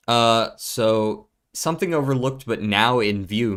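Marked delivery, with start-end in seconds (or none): none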